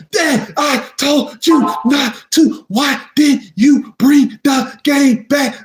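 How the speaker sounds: high, slow, word-by-word scolding; scolding while whipping. Retold or imitated. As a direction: space out each word like belt strokes. Mom whooping him a second time.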